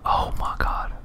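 ordinary talk, nothing marked

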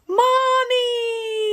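high, loud delighted cry; child yelling happily as Mommy comes home; joyful shout. Acted. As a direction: call out to her mom with joy. Child greets returning mother.

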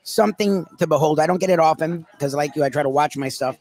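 ordinary talk

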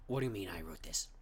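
ordinary talk

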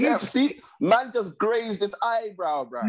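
slow, high-pitched downplaying; dismissive protest. Real, not acted. Co-host playing down the eye poke to his friend, dismissive.

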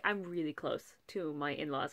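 ordinary talk

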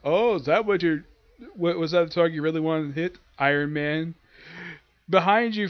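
usual speaking voice

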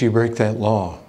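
normal talking voice

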